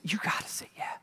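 soft breathy urging; hushed earnest whisper; whispered plea. Real, not acted. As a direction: whisper the invitation. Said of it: "I'm just gonna say".

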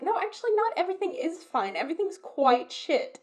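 acted blunt admission, pitched high; mock exasperated correction; frank contradiction. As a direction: drop the pretense with emphatic protest. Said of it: "You're being like".

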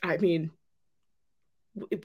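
ordinary delivery

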